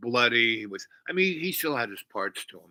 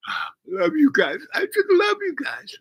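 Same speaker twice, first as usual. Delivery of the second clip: much higher, loud happy dog voice; voicing the dog; 7 dB louder than usual. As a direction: gush like a grateful dog. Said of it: "And he said".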